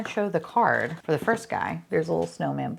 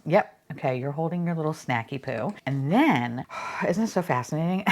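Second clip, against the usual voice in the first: normal talking voice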